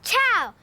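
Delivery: Ciao said the Italian way, one quick chow syllable; Italian hello. Shown as a demonstration. Teaching the Hoobs hello in Italian, Ciao said the Italian way. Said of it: "That's how they say it in Italy".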